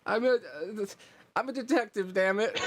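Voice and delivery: high, panicky squeak of a clumsy kobold; flustered rookie kobold. Acted. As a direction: blurt it out high and flustered, half laughing. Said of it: "and he's like".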